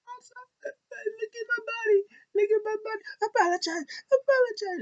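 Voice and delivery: very high, whiny fake crying plea; hammed-up pitiful wail; mock begging. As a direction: beg for mercy in a squeaky sobbing voice. Voicing beaten wrestler begging.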